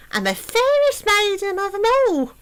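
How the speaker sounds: very high squeal; squealing vanity. Acted. Princess squeals about being the fairest, very high. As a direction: squeal it with vain delight.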